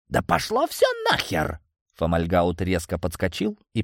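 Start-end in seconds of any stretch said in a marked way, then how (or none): 0.1-1.6 very high-pitched outburst; furious outburst. A narrator's reading. Villain jumping up to throw a chair, furious. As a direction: explode in frustration.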